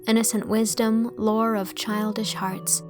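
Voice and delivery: ordinary delivery, nothing marked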